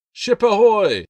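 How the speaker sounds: high, far-off yell from the mast; lookout's shout. Acted. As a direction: call it down from the crow's nest, full voice. Lookout's cry from above.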